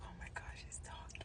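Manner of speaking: breathless whisper of disbelief; stunned whisper. Retold or imitated. Nurse whispering to herself beside the patient, awed whisper. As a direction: barely breathe the words, astonished.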